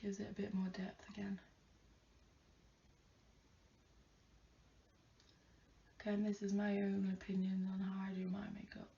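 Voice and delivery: soft unvoiced whisper, lips close to the mic; whispered aside. Real, not acted. To viewers mid-eyeliner, a hushed whisper. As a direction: murmur it to yourself while concentrating.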